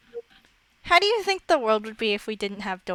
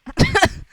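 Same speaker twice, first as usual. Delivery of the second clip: laugh; 12 semitones higher, 5 dB louder than usual. Real, not acted. Laughs at Minecraft logic.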